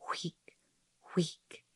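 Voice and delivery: very soft whispery whick, twice; tiny hushed swish noises; imitated fabric swish. A narrator's reading. Soft swish of cotton on cotton, imitated quietly.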